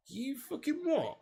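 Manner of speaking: slow, high, incredulous you what; stunned disbelief. Real, not acted. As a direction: drag it out in utter disbelief. Reading a shocking chat message.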